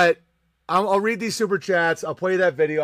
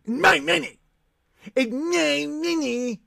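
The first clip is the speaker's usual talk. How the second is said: put-on much higher, slow childish whine; whiny mock voice; 8 semitones higher, slower than usual. Streamer showing a stingy viewer how he sounds. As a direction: whine it like a greedy toddler. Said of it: "That's how you sound".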